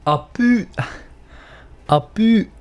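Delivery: "A boot" with a Canadian "about" sound, high and loud; mock Canadian accent. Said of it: "I really can't do accents at all".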